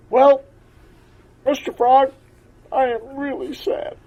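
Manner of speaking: put-on high, loud childlike voice; young unicorn telling the frog his sorrow; sad little unicorn. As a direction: squeak it out like a tearful little one. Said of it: "started to cry a little".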